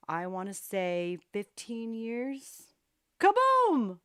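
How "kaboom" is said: high, loud explosive kaboom; mock explosion shout. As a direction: blast out a kaboom. Sudden loud sound effect.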